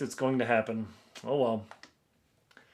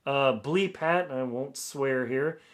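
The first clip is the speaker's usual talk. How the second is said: normal talking voice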